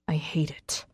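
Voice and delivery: soft, hushed male character; low voice. A narrator's reading. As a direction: say it low and quiet. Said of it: "his voice low".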